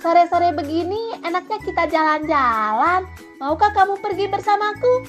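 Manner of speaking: high, inviting monkey voice; wheedling, lilting invitation; coaxing monkey. Acted. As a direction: tempt a friend along for a stroll. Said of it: "ujar si kera seraya membujuk".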